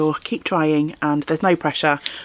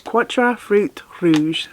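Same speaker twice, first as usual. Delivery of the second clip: slow, higher attempt at French; awful French accent; 5 semitones higher, much slower than usual. Shown as a demonstration. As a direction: read it slowly in an amateur French accent. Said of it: "my awful French accent".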